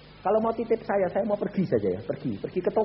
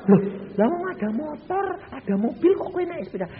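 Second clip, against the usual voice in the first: surprised protest; 12 semitones higher, 4 dB louder than usual. Retold or imitated. Husband protesting wife's bicycle plan.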